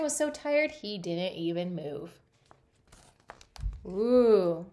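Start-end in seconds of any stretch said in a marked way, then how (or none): none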